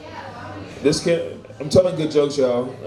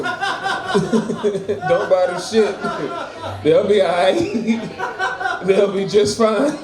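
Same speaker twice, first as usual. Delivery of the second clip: laughing; 4 semitones higher, much slower than usual. Real, not acted. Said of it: "laughing through his own joke".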